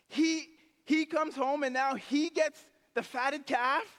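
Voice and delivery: put-on jealous older brother ranting to the worker, high and loud; indignant rant. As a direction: pour out the grievance, loud and high.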